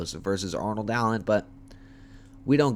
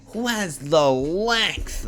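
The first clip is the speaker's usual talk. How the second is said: joking; 6 semitones higher, 4 dB louder, much slower than usual. Pretend. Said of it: "No, I'm kidding".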